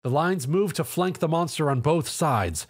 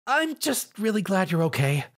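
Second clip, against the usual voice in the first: nervous; 7 semitones higher than usual. A narrator's reading. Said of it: "she nervously replied".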